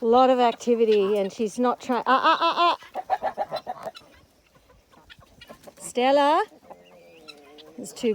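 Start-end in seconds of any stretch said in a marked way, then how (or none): none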